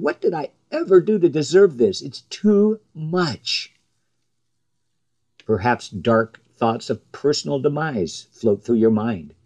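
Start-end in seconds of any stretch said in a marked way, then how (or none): 0.0-3.7 high-pitched protest, drawing out "too much"; mild anguished cry. Acted. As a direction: cry out to God in mild anguish, not a full scream. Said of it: "your soul screams at God".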